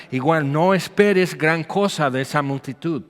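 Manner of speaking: ordinary talk, nothing marked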